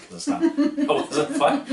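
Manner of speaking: can't keep a straight face; laughing. Real, not acted. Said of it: "I even snorkeled on that one".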